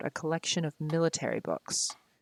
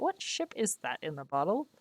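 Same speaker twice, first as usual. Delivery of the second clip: ordinary delivery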